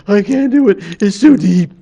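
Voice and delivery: high, loud heron winded from diving; out of breath. Acted. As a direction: gasp it out, beaten by the dive. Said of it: "and he comes back".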